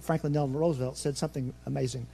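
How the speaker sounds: rushed and quiet; nervous fast talk. Real, not acted. Pastor rushing his sermon from nerves, fast and hushed. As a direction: hurry through it, nervous. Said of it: "I'm nervous, that's why I'm talking fast".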